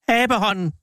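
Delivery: high cry at no extra volume; wild cry. A narrator's reading. Wife wakes her husband with a sudden idea, crying wildly. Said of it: "skreg hun vildt".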